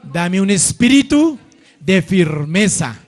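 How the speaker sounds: loud and slow, a bold plea; loud slow prayer line. Shown as a demonstration. Preacher leads the church in a loud slow prayer. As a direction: declare it slowly for all to repeat.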